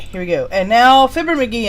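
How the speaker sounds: ordinary talk, nothing marked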